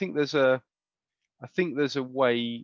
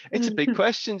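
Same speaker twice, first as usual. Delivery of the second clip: laughing; 11 semitones higher, 4 dB louder than usual. Real, not acted. Laughing after the big sigh.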